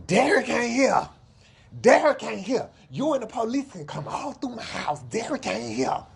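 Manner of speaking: high, loud sing-song lie; mocking Alexis. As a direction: imitate her brushing off the police, high and shrill. Mocking Alexis lying for Derek.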